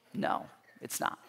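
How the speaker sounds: whispered no; whispered answer. Real, not acted. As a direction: breathe the answer, unvoiced. Answers his own question.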